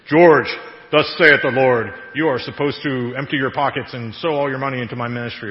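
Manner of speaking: loud, booming King James prophet parody; hammed-up, stilted holy pronouncement; mock deep prophet voice. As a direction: boom it out like a put-on prophecy. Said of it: "don't put on your deep voice".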